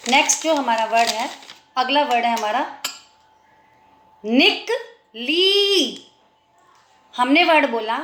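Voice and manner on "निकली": "निकली" slowly, short "निक", pause, long "ली"; syllable by syllable. Shown as a demonstration. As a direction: break up "निकली" and stretch the last ee. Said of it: "ल में बड़ी की मात्रा".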